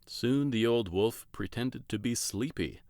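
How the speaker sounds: plain reading voice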